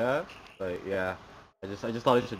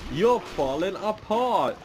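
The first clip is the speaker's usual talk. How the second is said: teasing pun; 6 semitones higher, 4 dB louder, much slower than usual. Real, not acted. Gloating pun at zombie game.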